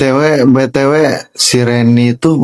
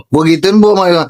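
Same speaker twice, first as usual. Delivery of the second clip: gleeful boast; 6 semitones higher than usual. Real, not acted. Triumphant retelling with laugh.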